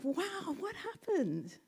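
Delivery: high, amazed wow; surprised frog. Retold or imitated. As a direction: gasp it out in wonder. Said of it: "is like".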